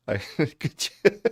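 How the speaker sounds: helpless laughter breaking up words; cracking up. Real, not acted. Streamer imagining the crying YouTuber, real cracking up. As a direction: try to speak through real laughter.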